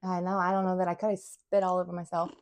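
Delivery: normal talking voice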